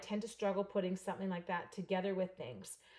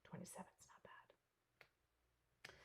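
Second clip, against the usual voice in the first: whisper; 22 dB quieter, much slower than usual. Real, not acted. Muttered aside.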